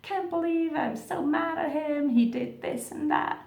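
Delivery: high mock-furious rant at the twin; mock outrage. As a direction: vent like a wronged partner, hammed up. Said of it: "You're like".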